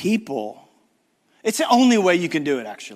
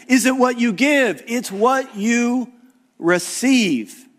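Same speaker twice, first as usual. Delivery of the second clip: loud, high-pitched preaching, slowing down on the key words; 9 semitones higher, 4 dB louder than usual. Acted. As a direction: proclaim it to the whole hall and stretch the ending. Preaching at full force.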